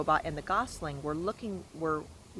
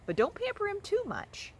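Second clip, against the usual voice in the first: put-on much higher scolding old goose; fussy goose. A narrator's reading. Goose lecturing the robot on motherhood, high. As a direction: wag a finger like a fussy goose.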